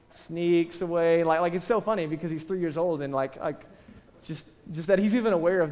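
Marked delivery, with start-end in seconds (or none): 0.1-1.2 drawn out sneakily. Acted. Describing son sneaking off.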